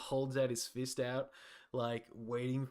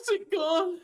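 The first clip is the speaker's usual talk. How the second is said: scared; 8 dB louder than usual. Acted. NPC still terrified of rat.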